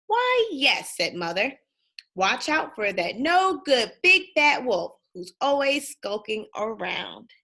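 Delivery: put-on lively read-aloud for kids, bouncy tune; storybook reading. A narrator's reading. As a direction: read it like bedtime story time.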